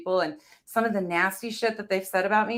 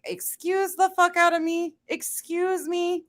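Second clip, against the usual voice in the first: indignant excuse me; 10 semitones higher, slower than usual. Retold or imitated. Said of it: "And it's like".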